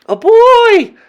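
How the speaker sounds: high, loud witch cry; shocked cry. A narrator's reading. Witches learning a boy is near, loud cry. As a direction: cry out in horror. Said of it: "cried the witches".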